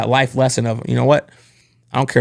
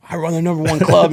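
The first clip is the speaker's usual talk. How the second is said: higher-pitched, mad protest; retold indignation; 6 semitones higher than usual. Young manager objects to boss's criticism, higher indignant retelling. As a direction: protest in a raised pitch, re-enacting your anger. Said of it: "I'm mad".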